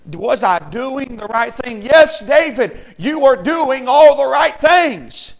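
rousing shouted encouragement to the church; full-force preaching. Real, not acted. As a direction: preach it at the top of your voice.

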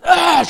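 loud, high acted "ah" of fury; hammed-up furious groan; exasperated mad cry. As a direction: burst out in mock outrage. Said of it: "she's so mad".

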